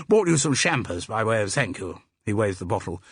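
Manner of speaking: jovial public school English; hearty public school accent. A narrator's reading. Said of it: "in a hearty public school accent".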